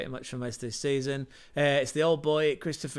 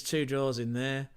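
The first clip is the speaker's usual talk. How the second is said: normal talking voice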